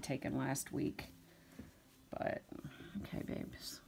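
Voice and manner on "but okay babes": breathy whisper to puppies. Real, not acted. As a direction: whisper it gently. Soft words to puppies.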